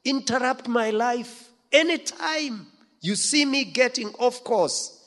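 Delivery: high, pleading prayer voice; lifted, imploring prayer; earnest prayer. Acted. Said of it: "we need to pray".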